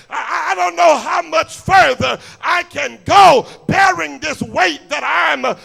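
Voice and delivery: rising, shouting preacher cadence; preaching at full force. Acted. Preacher pressing the congregation, full-force shout. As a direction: drive the question home to the church.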